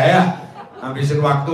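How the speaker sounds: normal talking voice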